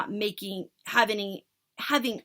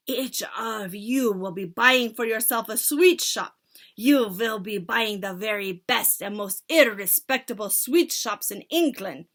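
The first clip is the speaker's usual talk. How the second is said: loud witch leader giving orders; commanding witch; 2 semitones higher, 7 dB louder than usual. Acted. Witch leader instructing her followers, loud. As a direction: command the crowd grandly.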